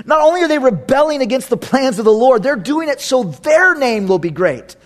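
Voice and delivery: ordinary talk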